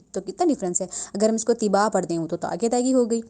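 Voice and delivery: ordinary talk, nothing marked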